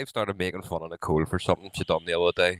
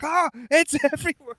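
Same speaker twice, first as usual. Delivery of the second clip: shrieking, much higher, laughing through it; high mock panic. Presenter watching colour flood the screen, shrieking high mock panic. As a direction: squeal in fake horror.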